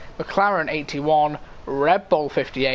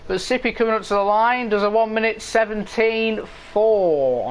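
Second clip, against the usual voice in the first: higher-pitched, lively race call; live play-by-play; 6 semitones higher than usual. Real, not acted. Commentator calling Sippy's lap time, higher live play-by-play. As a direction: call the driver home to the line.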